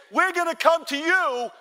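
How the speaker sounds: high, loud shouted threat; imitated call from far away; acted enemy yell. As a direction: holler it across the gap. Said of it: "But if they say".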